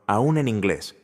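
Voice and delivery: ordinary delivery, nothing marked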